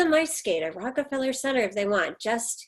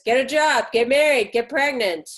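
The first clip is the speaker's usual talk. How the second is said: barked orders; 2 semitones higher, 7 dB louder than usual. Retold or imitated. Mother quotes her father's orders.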